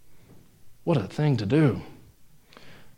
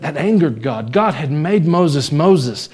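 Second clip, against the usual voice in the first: ordinary talk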